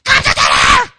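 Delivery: high, piercing mock scream; screaming mom. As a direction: holler at the kids at full volume. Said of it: "sounds like mom's voice".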